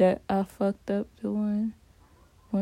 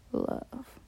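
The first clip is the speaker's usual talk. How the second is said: whispered; 3 semitones higher, 7 dB quieter than usual. Real, not acted. Confessing what broke him.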